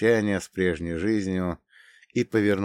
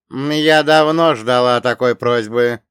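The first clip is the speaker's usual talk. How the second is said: echoing ethereal energy being; 4 semitones higher, 9 dB louder than usual. Acted. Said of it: "ответила Ц Б А".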